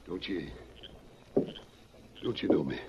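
tender, halting reunion; hushed plea. Acted. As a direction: ask gently, afraid she won't know him. Husband returns after long absence.

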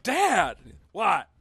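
high, stunned "Dad, what?"; shocked. Retold or imitated. Son reacting to drunk dad, stunned and high. Son's shocked reply retold.

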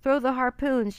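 storybook shouted order; sailor's yell. A narrator's reading. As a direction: yell the command to the crew. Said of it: "yelled the sailor".

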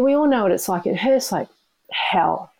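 ordinary talk, nothing marked

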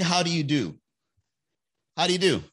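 a prim, breezy how-do-you-do; mock polite greeting. Shown as a demonstration. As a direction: greet the ex politely and keep walking. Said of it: "I think".

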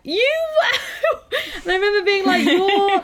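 laughing mock outrage. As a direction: splutter it through giggles. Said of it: "she'd be like".

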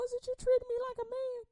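high, indignant challenge; high incredulous comeback; indignant retort. Retold or imitated. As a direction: throw the question back at him. Retort to the man.

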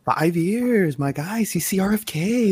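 high, drawn-out celebratory cheer; hyped congratulation. Real, not acted. Host cheering guest's five years in the crew, hyped congratulation.

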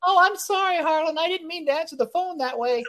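imitating a cowed assistant apologising to the boss; cowering apology. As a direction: cringe and grovel. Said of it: "if you cower and go".

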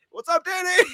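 high, hammed-up party greeting; owner's greeting. Owner greets Danny while bumping music, high excited call. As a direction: squeal it like a hyped bar owner. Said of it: "He's just going".

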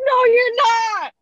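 put-on high, loud, slow kid's no; defiant four-year-old. Mom voicing her defiant son to the host, high, loud and slow. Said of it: "He's like".

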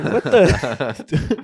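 usual speaking voice

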